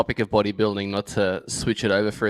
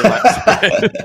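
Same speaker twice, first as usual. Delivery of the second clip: cracks up on rear lat spread, higher and louder; laughing; 12 semitones higher, 7 dB louder than usual. Real, not acted. Co-host jokes about bodybuilding poses, then laughs loudly. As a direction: bursts out laughing at his own punchline.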